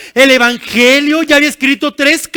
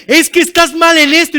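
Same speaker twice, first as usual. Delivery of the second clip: mild mock scolding; 5 semitones higher, 5 dB louder than usual. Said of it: "nos grita".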